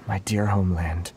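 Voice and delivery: ordinary delivery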